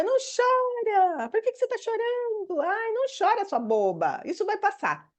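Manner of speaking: high, dismissive teacher voice; mocking a teacher hushing a crying child; mock teacher scolding. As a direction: imitate a teacher brushing off a crying kid.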